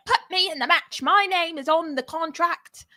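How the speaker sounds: put-on Rhea demanding a match from the GM in a British accent. Friend impersonates Rhea to the GM in a British accent. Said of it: "That's British, not Australian".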